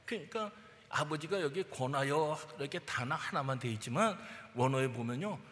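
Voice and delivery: usual speaking voice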